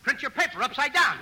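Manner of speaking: ordinary delivery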